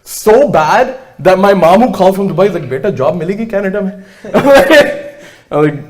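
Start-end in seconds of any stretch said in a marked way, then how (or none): 2.7-4.0 mother voiced. Mom calling worried from Dubai. 4.2-5.2 real laugh. Real, not acted. Laughs at own story.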